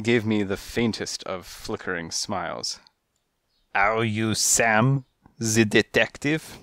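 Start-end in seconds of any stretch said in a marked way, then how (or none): 3.7-6.6 put-on French accent. Cat visitor asks the detective in a French accent. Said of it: "His accent was French".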